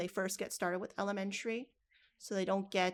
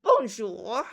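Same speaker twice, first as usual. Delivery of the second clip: put-on a louder, French-accented "Bonjour"; 11 dB louder than usual. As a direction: greet them like a French speaker. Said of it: "start practicing your French".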